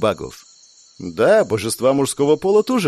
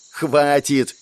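drawn-out cry to break up a quarrel; mild yell; 2 semitones higher than usual. A narrator's reading. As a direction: cut off the squabble with a contained yell. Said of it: "оборвал начавшуюся перепалку вопль Ребекки".

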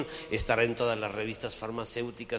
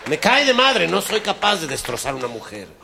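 higher, loud exclamation; loud protest; 7 semitones higher, 12 dB louder than usual. Acted. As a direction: cry out he can't do it. Hombre exclama alarmado. (exclamación fuerte y aguda)

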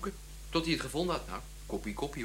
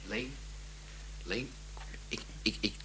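whisper; 3 semitones lower, 9 dB quieter than usual. Acted. Sam whispers a confidential question.

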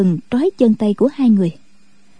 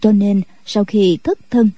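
ordinary delivery, nothing marked

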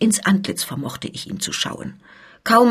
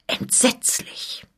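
slow, horrified whisper; 7 dB quieter, slower than usual. A narrator's reading. Scuderi hears of Cardillac's crimes, whispering in horror. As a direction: breathe the word out in shock.

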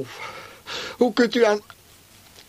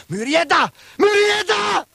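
much higher, loud shouted name; calling out in panic; 5 dB louder than usual. Retold or imitated. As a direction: cry the name out as she runs. Retold shout after sister.

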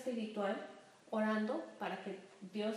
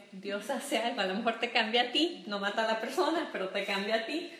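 normal talking voice

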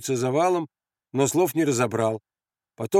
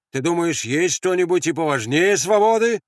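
raised, high, defiant question; challenging tone; 5 semitones higher, 4 dB louder than usual. Acted. Grenade thrower testing Andres on freedom, challenging. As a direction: dare him to disagree. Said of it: "спросил он вызывающим тоном".

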